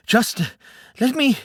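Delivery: panting between words; gasping after a run through the forest; out of breath. Acted. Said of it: "catch my breath".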